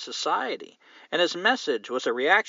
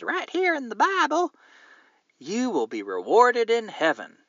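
exaggerated pulpit proclamation; mock preacher; 10 semitones higher than usual. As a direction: preach the promise like a parody evangelist. Mock preacher promising heaven.

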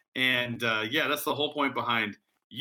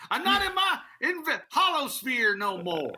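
put-on high-pitched crusty old miner; old prospector voice; 10 semitones higher than usual. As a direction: play a creaky old gold digger. Joining the old prospector bit.

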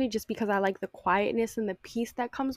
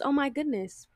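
normal talking voice

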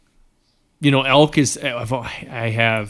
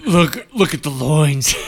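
mock leering; 3 semitones higher, 6 dB louder, slower than usual. Mocking ogling the horse.

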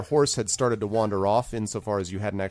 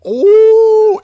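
loud, high howl in reply; full-voiced sustained wolf call; answering wolf howl; 17 dB louder than usual. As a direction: howl back like a distant pack. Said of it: "the returning call of".

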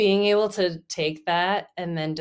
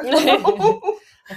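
laughing; 11 semitones higher, 7 dB louder than usual. Real, not acted. Amused reply to swear.

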